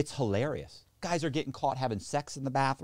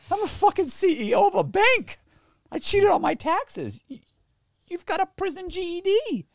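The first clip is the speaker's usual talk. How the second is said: much higher, louder, indignant squeal; mock outraged CEO; 5 dB louder than usual. Bank CEO outraged at a crackhead, mock shrill squeal. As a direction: spit out the disbelief, hammed up.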